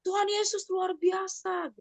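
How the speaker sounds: high, slow mock cry of praise, kept soft; retold exclamation. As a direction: lift it up in wonder but keep it soft and slow. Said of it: "Terus dia teriak kan".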